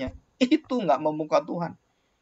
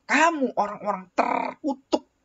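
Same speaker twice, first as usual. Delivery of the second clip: God's curse; 9 semitones higher, 4 dB louder than usual. Acted. Preacher reading God's curse on the damned, higher and stern. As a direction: pronounce the curse like a judge. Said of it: "Dikatakan di sini".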